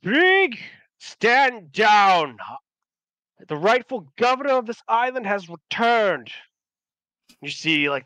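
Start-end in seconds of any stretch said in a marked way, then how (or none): 0.0-6.3 high, loud proclamation; commanding shout. Acted. Returned governor confronts Krieg, commanding shout. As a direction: order the usurper to yield. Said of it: "Stephen finally speaks up and goes".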